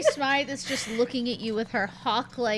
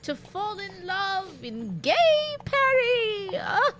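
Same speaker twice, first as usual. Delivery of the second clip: put-on higher, swooning, teasing old woman; teasing old lady; 6 semitones higher, 3 dB louder than usual. Mary Smythe teases Vilma about her Paris romance, higher and swooning.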